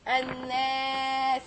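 "Annas" with doubled N and long A; long, steady vowel ending "Annas"; shadda demonstration. Shown as a demonstration. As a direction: draw "Annas" out as an-naaas. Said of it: "I put the shadda".

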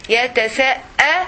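"يتساءلون" broken before the hamza, with a long final "lūn"; word in halves. Shown as a demonstration. Teacher showing both halves of the word, "يتساءلون" split apart. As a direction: split "يتساءلون" into two halves with a clear break. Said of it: "the other half".

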